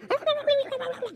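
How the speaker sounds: a very high, thin squeal like a voice through a phone; imitated phone squawk. Youth pastor imitating an angry mom over the phone, high squeal. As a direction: mimic the mom's tinny screech down the line. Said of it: "you just hear".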